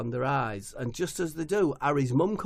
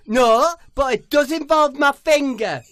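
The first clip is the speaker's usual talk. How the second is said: high, loud proud boast; high loud boastful footballer; 12 semitones higher, 11 dB louder than usual. Acted. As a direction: announce it grandly.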